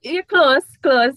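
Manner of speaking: clipped English version said loudly; English phrasing. Shown as a demonstration. Teaching the English version, said loud and clear. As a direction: say close as a plain English command. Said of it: "You could have said".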